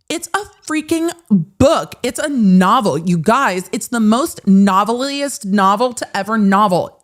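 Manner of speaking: high, hammed-up mock protesting; mock insistence. Defendant to her brother about the book, mocking insistence.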